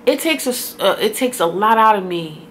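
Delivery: ordinary talk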